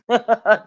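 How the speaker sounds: short loud burst of laughter. Real, not acted. Reading a shady post aloud, laughing out loud. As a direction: laugh out at the shade.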